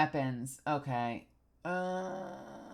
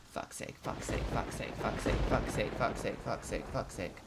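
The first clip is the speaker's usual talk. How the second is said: hushed breathy cursing on a loop; frustrated whispered chant; 12 semitones lower, 11 dB quieter than usual. Real, not acted. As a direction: curse under your breath over and over. Frustrated muttering under breath.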